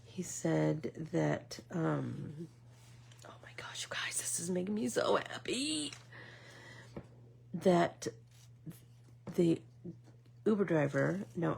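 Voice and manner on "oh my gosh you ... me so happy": excited whisper to the viewers; a gleeful secret whisper. Real, not acted. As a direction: breathe it out, thrilled and hushed.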